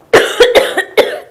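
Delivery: loud, repeated fake coughing; demonstrated cough. Shown as a demonstration. Teacher introduces the next word, demonstrated coughing fit. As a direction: cough hard several times. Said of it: "a cough".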